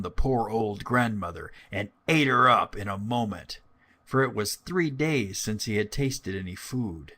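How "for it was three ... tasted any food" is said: plain reading voice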